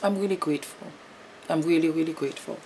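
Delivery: soft, moved thanks; choked-up gratitude. Real, not acted. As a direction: near tears, quietly grateful. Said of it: "I'm getting emotional".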